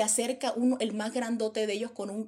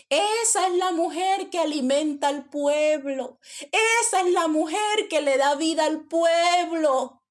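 loud, raised, slowed proclamation; shouting; 6 semitones higher, 6 dB louder than usual. Acted. Prisoner vouching for the woman inside the jail, shouting. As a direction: cry it out to the whole crowd. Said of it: "Y un hombre grita".

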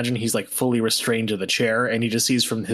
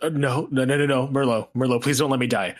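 freaking out, stammering and begging; desperate pleading; 3 semitones higher, faster than usual. Acted. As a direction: beg not to be left to die. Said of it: "he just kind of pleads".

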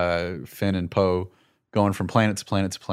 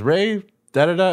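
higher, louder skimmed-over call; mock a quick shouted line; mock yell; 10 semitones higher, 5 dB louder than usual. Retelling Poe's call to show the timing, mock yell. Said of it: "Poe yells out".

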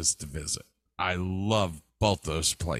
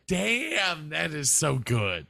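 long, high-pitched "damn" of admiration; blown-away movie fan reaction; wowed reaction; 7 semitones higher, slower than usual. Retold or imitated. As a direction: savor it, starting high and easing down. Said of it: "I'm like".

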